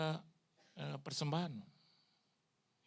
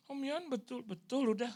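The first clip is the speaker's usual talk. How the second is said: lightly voiced tearful woman; 10 semitones higher, 3 dB louder than usual. Voicing pastor's wife again.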